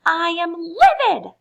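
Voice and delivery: loud slow furious outburst; exploding rage. Acted. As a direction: explode with fury. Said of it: "she exploded".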